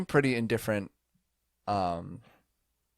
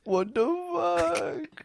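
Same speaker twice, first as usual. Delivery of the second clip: put-on high, slow, cutesy baby talk; much slower than usual. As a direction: whine it like a tiny child. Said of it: "Don't use a baby voice".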